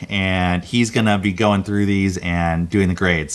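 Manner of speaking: normal talking voice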